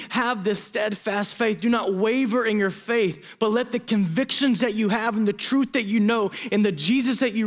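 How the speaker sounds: rising pastor's appeal, slowed on the key words; preaching at full force. Acted. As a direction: preach it, pleading with the room.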